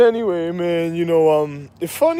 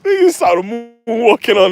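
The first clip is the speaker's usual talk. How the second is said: ordinary talk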